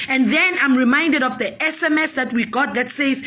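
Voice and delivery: ordinary talk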